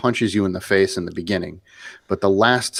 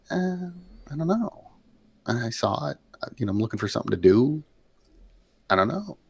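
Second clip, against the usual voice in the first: soft, higher mock-clueless voice; clueless shrug; 6 semitones higher, 6 dB quieter, faster than usual. Would-be member asked why he wants to join, vague shrug. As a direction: imitate a noncommittal applicant with no real reason. Imitating an unthinking lodge applicant.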